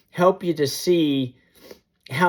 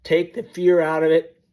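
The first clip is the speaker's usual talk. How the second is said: normal talking voice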